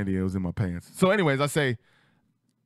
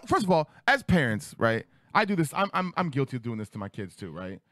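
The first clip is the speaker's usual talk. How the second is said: ordinary delivery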